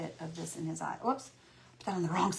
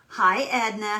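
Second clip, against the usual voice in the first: usual speaking voice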